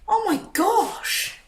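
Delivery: very high, slow squeal; excited. Real, not acted. Thrilled exclamation.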